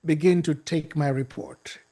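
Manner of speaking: ordinary talk, nothing marked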